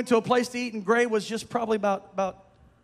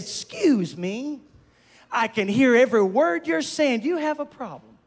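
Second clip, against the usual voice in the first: indignant wife; 3 semitones higher, 5 dB louder than usual. Retold or imitated. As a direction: imitate a mama bear challenging the next table. Wife confronting diners, retold.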